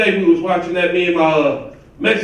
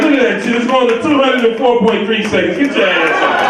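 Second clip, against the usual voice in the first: mock yell; 6 semitones higher, 4 dB louder than usual. Yelling at wife on the scale.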